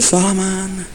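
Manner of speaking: soft, slow, drawn-out divine call; voice of the Lord. Acted. Preacher as the Lord speaking in Solomon's dream, soft and slow. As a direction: call softly as God in a dream, stretching the words. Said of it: "the Lord in one night in a dream said".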